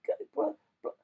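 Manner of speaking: high, loud mock British accent, hammed up; bad British accent. Shown as a demonstration. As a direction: play a clumsy Brit with a squeaky voice. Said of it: "I don't know how to do any accents".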